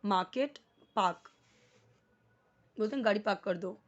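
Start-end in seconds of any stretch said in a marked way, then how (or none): 0.0-0.6 मार्केट as "maa-ket", no r; silent r. Shown as a demonstration. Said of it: "आर यहाँ पे silent है". 1.0-1.3 पार्क as "paak", no r; silent r. Shown as a demonstration. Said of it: "आर यहाँ पे silent है".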